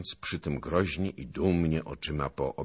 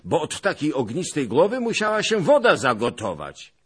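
loud, high mocking anger; angry; 12 semitones higher, 10 dB louder than usual. A narrator's reading. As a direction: scoff at him loudly in anger. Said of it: "rzekł z gniewem".

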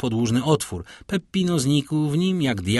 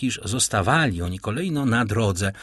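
ordinary delivery

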